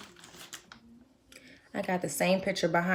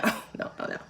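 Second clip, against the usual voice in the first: whispered no; 6 dB quieter, faster than usual. Retold or imitated. Mock horror at her edges.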